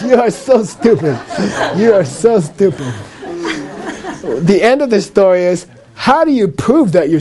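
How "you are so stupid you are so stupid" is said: laughing while calling someone stupid; husband insulting his wife, laughing mock scold. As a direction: giggle through a mock scolding. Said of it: "your husband says".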